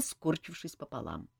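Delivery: ordinary delivery, nothing marked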